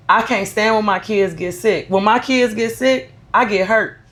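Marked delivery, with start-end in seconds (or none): none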